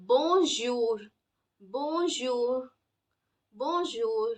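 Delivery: "bonjour" with a soft zh as in measure, drawn out slowly; careful French "bonjour", tongue not touching on bon. Shown as a demonstration. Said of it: "the correct pronunciation of this would be".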